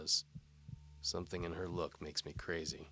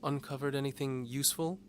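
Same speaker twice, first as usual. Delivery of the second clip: higher, louder teasing lady voice; seductive noblewoman; 10 semitones higher, 5 dB louder than usual. A narrator's reading. Antimony dares the knight to follow. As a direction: tease the knight like a bold noblewoman.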